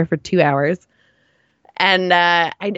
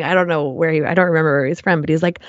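normal talking voice

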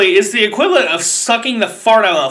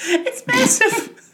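laughing; 11 semitones higher, 4 dB quieter, slower than usual. Real, not acted. Breaking into laughter telling story.

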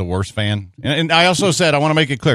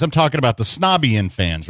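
normal talking voice

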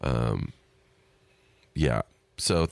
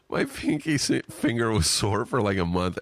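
higher-pitched, cracking up while reading; laughing; 6 semitones higher than usual. Real, not acted. Podcaster reading a listener's email about a sore pinky, laughing. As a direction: struggle to read it through real laughter. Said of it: "Sorry for laughing".

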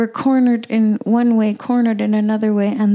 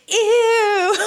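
disgusted ew; 12 semitones higher than usual. Retold or imitated. Imitating reaction to touching dead cow.

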